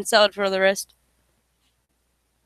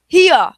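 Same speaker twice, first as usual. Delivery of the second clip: loud holler; 7 semitones higher, 9 dB louder than usual. Acted. Smee hollers.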